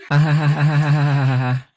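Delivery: slow, high, stretched-out cackle; long drawn-out laugh. Acted. Laughing at the other after the shot, drawn-out high laugh. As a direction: let the laugh roll out slowly and high.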